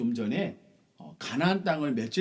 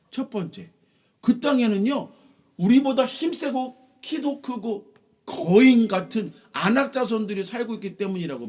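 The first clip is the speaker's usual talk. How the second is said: awed report; 9 semitones higher, 7 dB louder than usual. Acted. Spies describing giants.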